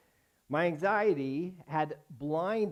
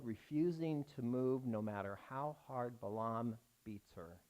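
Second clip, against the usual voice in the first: usual speaking voice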